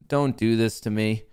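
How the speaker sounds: usual speaking voice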